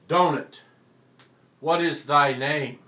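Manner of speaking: loud, commanding preacher call; booming exorcist demand. Acted. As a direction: cast out the donut demon like a tent preacher. Exorcist preacher demands donut's name.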